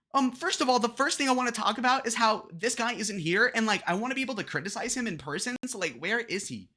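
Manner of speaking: high-pitched whiny parody of a candidate; mock whiny complaining. Reactor parodies candidate griping about absent rival, high whiny complaint.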